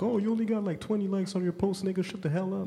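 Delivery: put-on high taunting young bully; mocking bully. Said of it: "are gonna be like".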